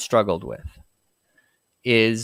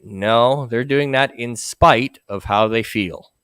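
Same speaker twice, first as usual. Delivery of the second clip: normal talking voice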